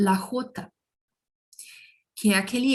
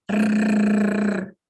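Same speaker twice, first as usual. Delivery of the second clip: long trilled rrr; rolled r. Shown as a demonstration. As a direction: trill the tongue on the palate. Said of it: "eu vou fazer um R bem vibrante".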